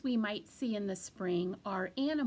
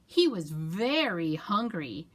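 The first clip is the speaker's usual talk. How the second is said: ordinary delivery, nothing marked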